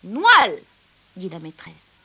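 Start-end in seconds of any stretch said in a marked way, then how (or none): 0.0-0.6 loud call of the name; calling the roll. Acted. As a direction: call the name out loudly, like a teacher. Teacher calls the register. (nom appelé d'une voix forte)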